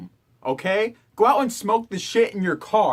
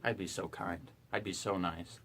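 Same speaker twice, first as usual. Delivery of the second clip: mock sweet; 10 semitones lower, 14 dB quieter than usual. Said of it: "A very kind manner".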